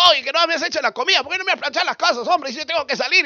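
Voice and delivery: nagging husband, quick and high-pitched; grumbling in a pestering rush; annoyed husband. Acted. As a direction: rattle off high, fast complaints.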